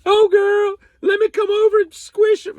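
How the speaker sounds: put-on high, loud squealing girly voice; mock girlfriend. Mocking a girlfriend offering herself, high squeal.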